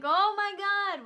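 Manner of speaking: high, drawn-out, hammed-up dismay; exclaimed dismay. Friend reacts to Nancy's outburst, dismayed. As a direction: stretch it out in mock disbelief.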